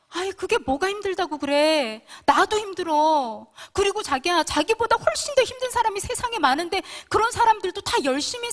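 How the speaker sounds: scolding husband. Retold or imitated. Wife berating her struggling husband, loud and exasperated. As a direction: push him hard, shouting him down for being weak. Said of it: "채근하고 채찍질하고 제가 몰아쳤던".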